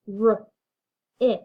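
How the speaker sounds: K-r-i-s split into separate sounds; tapping out sounds. Shown as a demonstration. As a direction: tap each sound of Chris apart. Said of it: "Let's tap Chris".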